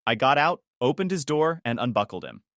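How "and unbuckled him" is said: ordinary delivery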